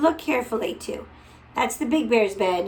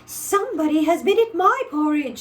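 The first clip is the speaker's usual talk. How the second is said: higher, louder mama bear; mother bear, pitched up; mother bear complaining, pitched up; middle-sized bear; 6 semitones higher, 6 dB louder than usual. A narrator's reading. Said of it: "in her middle voice".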